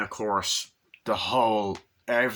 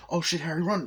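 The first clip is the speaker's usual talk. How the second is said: mock urgent warning, pitched up; retold alarm; 7 semitones higher, faster than usual. Movie agent warns Harry to run, mock alarm. Retold panic in action scene.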